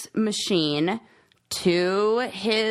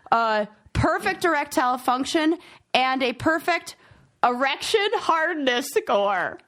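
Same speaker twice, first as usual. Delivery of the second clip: usual speaking voice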